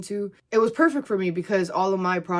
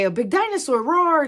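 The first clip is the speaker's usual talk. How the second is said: higher, louder mock dinosaur roar; playful roar for a child; 9 semitones higher, 5 dB louder than usual. Vendor playing dinosaur for a boy, mock roar. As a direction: play a dinosaur for a little boy. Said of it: "I'd say".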